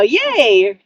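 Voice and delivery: ordinary talk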